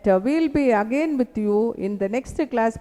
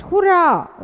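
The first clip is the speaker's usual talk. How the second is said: higher, louder match-crowd hurrah; cheering shout; 6 semitones higher, 5 dB louder than usual. Acted. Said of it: "you raise your hands and shout".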